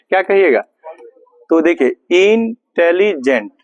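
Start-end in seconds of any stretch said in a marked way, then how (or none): 2.3-3.5 intelligent read haltingly as इन, टे, लि, gent; teacher sounding out intelligent piece by piece; broken into syllables. Shown as a demonstration. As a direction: pause between the parts of intelligent. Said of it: "break मतलब रुक रुक कर पढ़ना".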